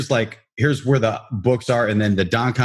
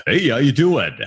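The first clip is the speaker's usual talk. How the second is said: higher, punchy on-air greeting; radio voice; 4 semitones higher than usual. Shown as a demonstration. Host shows how he greets when the red light comes on, radio voice. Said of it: "when the red light comes on".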